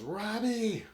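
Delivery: high call across the factory floor; retold yell. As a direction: call out to Robbie from far away. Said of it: "And she yells".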